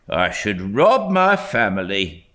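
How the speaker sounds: lightly voiced haggling old dealer; a hint of a grumbling shopkeeper; gruff shopkeeper. A narrator's reading. Shopkeeper haggling with a boy over a waistcoat, lightly gruff.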